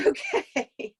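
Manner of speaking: a bright laugh breaking into okay; laughing okay after crying; laughing through tears. Real, not acted. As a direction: laugh it off and reset.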